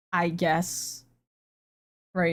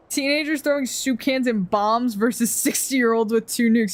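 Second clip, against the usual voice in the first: normal talking voice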